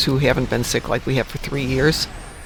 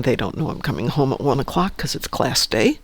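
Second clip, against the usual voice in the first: ordinary talk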